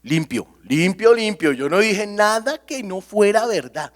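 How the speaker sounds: hammed-up self-righteous insistence; mock self-justification. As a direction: protest your innocence with exaggerated smugness. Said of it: "usted diga".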